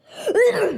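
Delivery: short, sharp real cough; quick chesty cough from a cold. Real, not acted. Streamer with a cold coughing again, short real cough. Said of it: "je suis un peu enrhumé".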